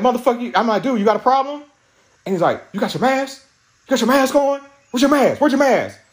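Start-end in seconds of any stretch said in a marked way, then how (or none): 0.0-1.6 higher-pitched retold challenge; retold confrontation. Retells confronting the man. 2.7-6.0 high-pitched, insistent mock questioning; retold badgering. Acts out man demanding mask.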